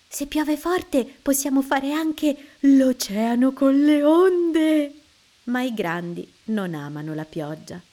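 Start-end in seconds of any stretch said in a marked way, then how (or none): 0.1-5.0 put-on high, loud, slow child's delight; excited child. A narrator's reading. Liu thrilled about the rain, rising high and loud. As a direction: build up thrilled about waves.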